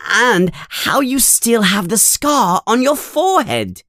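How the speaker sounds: high-pitched, gushing little fan; Colin Creevey, very excited. A narrator's reading. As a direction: pour it out excitedly to your hero. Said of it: "Colin is talking very excitedly".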